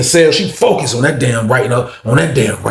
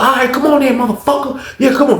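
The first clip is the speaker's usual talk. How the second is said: high, heated mock challenge; goading dare; 11 semitones higher than usual. Officer daring the inmate to come, angry goading. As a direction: imitate her egging him on, pitched up.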